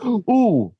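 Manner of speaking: a shocked little ooh, hammed-up; mock shocked gasp at a curse word. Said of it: "I would say it not even like".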